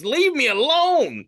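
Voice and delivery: high, drawn-out hammed-up protest; mock distressed plea. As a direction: wail it slowly in fake distress. Acts out being recognised at work.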